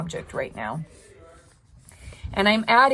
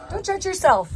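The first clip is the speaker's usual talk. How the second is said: "Don't judge yourself" jumped way up high, set apart from her own voice; high, pointed repeat of "judge"; high emphatic; 11 semitones higher than usual. Shown as a demonstration. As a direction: lift it high to drive the advice home.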